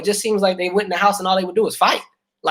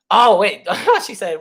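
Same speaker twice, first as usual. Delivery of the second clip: ordinary delivery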